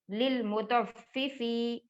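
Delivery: للمطففی drawn out very slowly; slow spelled recitation. Shown as a demonstration. Teacher recites للمطففی slowly for the children. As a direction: stretch للمطففی slowly, syllable by syllable. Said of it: "پہلے والے فا کو ہمیں جلدی پڑھنا ہے".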